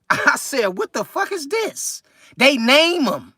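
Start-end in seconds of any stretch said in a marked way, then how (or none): 0.8-2.0 high, disbelieving question, pitched way up; stunned disbelief. Retold or imitated. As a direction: act floored by the answer. His reaction to the named toy.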